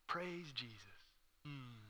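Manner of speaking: very soft, sarcastic praise; hushed pretend reverence with a doubtful hum; mock piety. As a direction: murmur it as if piously grateful. Mock pious reaction to being insulted.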